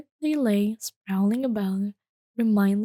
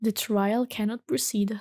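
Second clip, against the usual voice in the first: usual speaking voice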